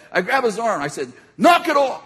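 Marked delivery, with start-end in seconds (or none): 1.4-2.0 high, loud, firm order; firm command. Retold or imitated. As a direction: call it out like a confident rescuer. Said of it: "I think it was more like".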